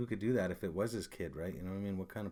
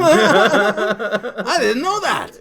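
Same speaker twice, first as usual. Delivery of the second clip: laughing; 12 semitones higher, 16 dB louder, much slower than usual. Real, not acted. Laughing at own realization.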